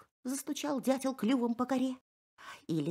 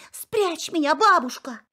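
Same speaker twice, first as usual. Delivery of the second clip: pleading hare; 7 semitones higher, 7 dB louder than usual. Acted. Hare begs pine.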